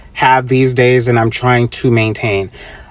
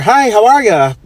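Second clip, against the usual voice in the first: mock friendly greeting; 7 semitones higher than usual.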